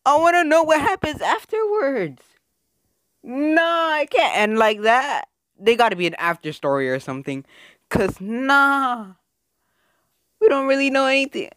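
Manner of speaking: high frustrated whine. Real, not acted. Gamer wants an after story, high frustrated whine. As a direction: whine for a sequel. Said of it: "begs for more story".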